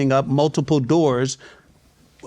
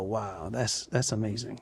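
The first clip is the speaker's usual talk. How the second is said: soft, much quieter wonder; quietly amazed, watching with the grandkids; hushed awe; 4 semitones lower, 12 dB quieter than usual. Retold or imitated. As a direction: breathe it out, moved. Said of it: "And you just go".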